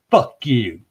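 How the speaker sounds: mildly imitated bold curse; loud and proud. Unknown woman's voice caught on video, mock bold curse. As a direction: mimic a brazen voice, without really shouting. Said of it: "just loud and proud".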